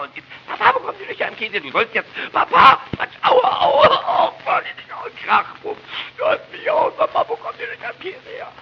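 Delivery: very high, loud crying wail; child howling. Acted. Boy howling after father smacks him, shrill and loud.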